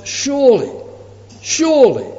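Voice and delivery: high, fervent repeated word; preacher's fervent repetition; fervent emphasis. Acted. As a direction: lift it with growing conviction. Repeated with rising fervor.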